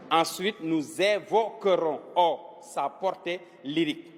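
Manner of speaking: drawn-out "nous évoquerons", syllable by syllable; slow dictation. Shown as a demonstration. Teacher repeating the sentence for pupils to write, slow dictation. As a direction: dictate slowly, separating each chunk.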